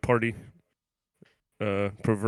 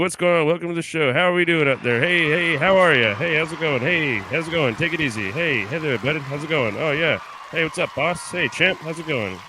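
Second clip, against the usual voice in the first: loud, high, bouncy host welcome to the crowd; hyped show host greeting; 6 semitones higher, 9 dB louder than usual. Acted. Podcast host greets imagined fans, loud high showman hype. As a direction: greet the audience like a big stage entrance.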